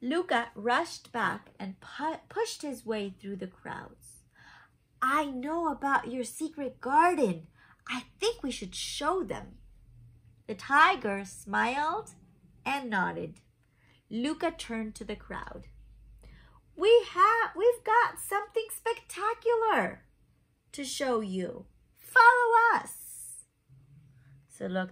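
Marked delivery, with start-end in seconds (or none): 0.0-15.7 lively picture-book narration; reading to children. A narrator's reading. Reads picture book aloud. 16.8-21.7 high, loud announcement; calling to a crowd. Acted. Luca calls out to the crowd. 22.0-23.5 high, loud announcement; calling to a crowd. Acted. Luca calls out to the crowd.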